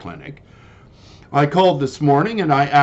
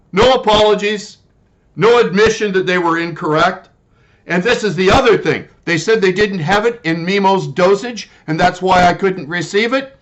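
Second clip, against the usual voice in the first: normal talking voice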